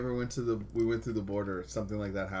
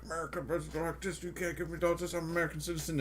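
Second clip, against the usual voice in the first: mocking entitled American; 8 semitones higher, faster than usual. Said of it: "one of those".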